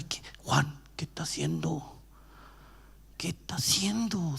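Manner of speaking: susurrando entre dientes, con miedo; susurro asustado. Retold or imitated. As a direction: como discípulo que cuchichea sin creer. Discípulos susurrando en la tumba. (susurro nervioso de discípulo)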